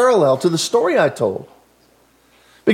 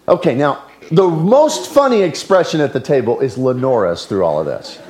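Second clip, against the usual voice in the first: usual speaking voice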